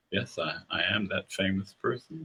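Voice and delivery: quiet, understated swagger; softly self-important, playing it down; slight swagger. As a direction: modest words with a quiet swagger. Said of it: "it was a slight swagger about".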